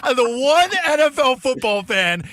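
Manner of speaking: high, loud mock rant, stretching the first words; exasperated yelling. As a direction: re-enact yelling at an annoying stranger. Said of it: "Why were you yelling at that guy".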